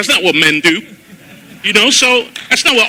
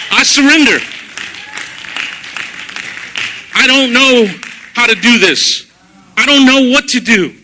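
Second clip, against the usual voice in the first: tearful prayer; 4 semitones higher than usual. Retold or imitated. Prayer on his knees, retold.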